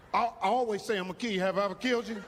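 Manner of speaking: usual speaking voice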